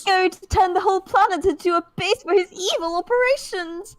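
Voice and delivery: menacing cartoon villain. Voicing villain line with game.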